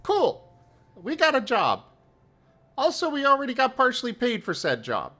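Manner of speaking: high, upbeat little voice; chirpy character, quick. Acted.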